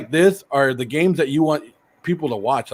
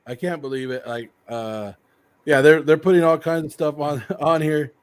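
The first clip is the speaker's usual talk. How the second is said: ordinary talk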